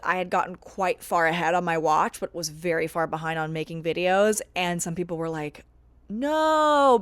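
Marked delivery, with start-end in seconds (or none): 6.1-7.0 drawn-out, high "no"; mock wail of protest, stretched out; dismayed fans. Fans hearing she watched too far ahead, dismayed.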